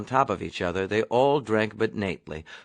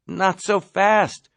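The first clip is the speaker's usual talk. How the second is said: urging; 8 semitones higher, 3 dB louder, slower than usual. Acted. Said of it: "urged Nately".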